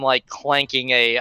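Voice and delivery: ordinary delivery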